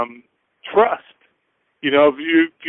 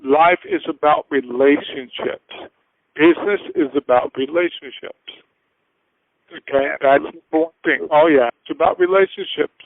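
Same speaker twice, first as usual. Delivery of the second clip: ordinary talk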